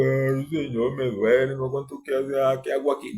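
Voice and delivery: a hint of a crybaby moaning to God; mock whining. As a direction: mimic a whiny complainer. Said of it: "No quedarme llorando". (lloriqueo burlón, imitado)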